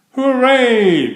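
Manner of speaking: high, loud cheer of joy; a big happy hooray. A narrator's reading. Friends cheering Jim on, high and loud. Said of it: "cheered his friends".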